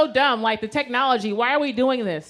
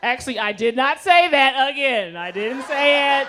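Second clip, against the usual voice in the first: loud, high-pitched backtracking; emphatic denial; 5 semitones higher, 6 dB louder than usual. Acted. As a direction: deny it loudly, stretching the end. Loud denial of what was retold.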